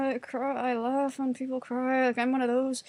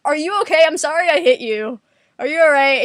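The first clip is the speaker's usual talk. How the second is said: loud, worried checking on someone; mock frantic apology; 4 semitones higher, 10 dB louder than usual. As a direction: blurt out exaggerated concern. Acts out apologising after hitting someone.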